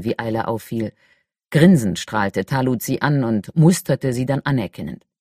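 ordinary delivery

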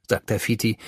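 ordinary delivery

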